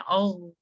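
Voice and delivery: a throaty gargling "औ"; gargle sound. Shown as a demonstration. Teacher demonstrating a gargle, throaty. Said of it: "हम अपनी आवाज को ऐसे करते हैं".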